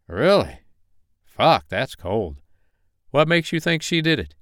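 polite Carolina investigator on phone; friendly investigator voice. Acted. Malone reacts on phone.

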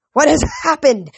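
high, alarmed demon cry; mild demon scream. A narrator's reading. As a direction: exclaim it as a startled demon, without fully screaming. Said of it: "one of the demons screamed".

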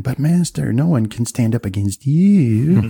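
high, sing-song toady flattering the master; fawning toady. Acted. Toady minion flattering the beholder master, fawning and high. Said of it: "some toady saying".

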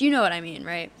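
ordinary talk

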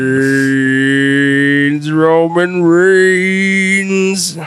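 drawn-out announcer call; ring announcer. Pretend. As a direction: stretch the name like a ring announcer. Announcer-style name call.

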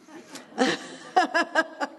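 real self-amused laugh. Real, not acted. Laughing about thinking all the time. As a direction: laughs at her own overthinking.

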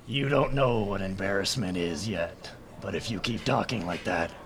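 low, soft threat; low menace. Acted. Hal leans down to threaten the rival, menacing. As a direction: intimidate quietly from a great height. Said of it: "lean all the way down and".

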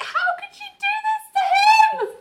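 very high, squealing lament; mock distraught. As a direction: wail it on his behalf, hammed-up. Mock heartbreak on Greg's behalf.

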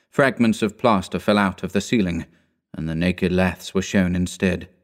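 usual speaking voice

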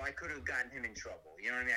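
usual speaking voice

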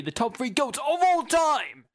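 high, slow, loud hype; announcer voice. Acted. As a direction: build it up like a game show host. Countdown host hyping top three.

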